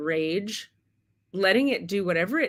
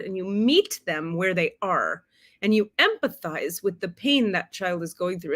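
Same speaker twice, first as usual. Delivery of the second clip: ordinary delivery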